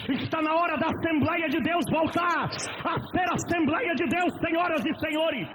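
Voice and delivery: shouted sermon, high and loud; fiery pulpit shout; preaching at full force. Acted. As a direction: thunder it at the church.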